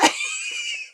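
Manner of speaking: shrill high laugh burst; high squealing laugh. Real, not acted. Laughing at his own joke.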